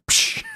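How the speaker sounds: imitated sliding-window whoosh; sharp "psh" for a window shutting; slamming window noise. Drive-thru window shutting on a customer, imitated hiss. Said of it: "the window just goes".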